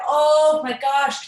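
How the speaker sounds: drawn-out amazed oh my gosh; slow, loud rush of wonder; excited gasp. Retold or imitated. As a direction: gush with sudden delight. Excited realization retold.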